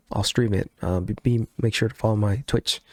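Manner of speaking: normal talking voice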